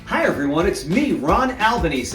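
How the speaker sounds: ordinary delivery, nothing marked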